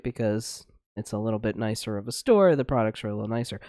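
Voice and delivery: ordinary delivery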